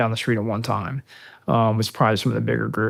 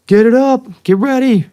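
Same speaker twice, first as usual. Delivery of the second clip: shouting orders over the noise, much higher and louder; retold shout; 12 semitones higher, 7 dB louder than usual. Sniper yelling at his team on the airfield, loud urgent shout. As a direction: bark it out to get the team moving.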